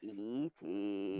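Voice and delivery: E T voice. Retold or imitated. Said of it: "doing Chills impressions".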